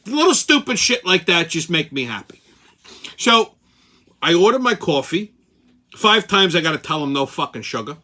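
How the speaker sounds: ordinary delivery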